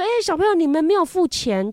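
put-on high, loud call after the kids; imitating the shop clerk's shout; mock clerk call. Store clerk calling the kids back to pay, mock loud call. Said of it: "他说".

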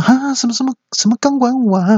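very high, startled questioning; high-pitched astonished wife; shocked wife. Acted. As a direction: squeal the question in mock disbelief.

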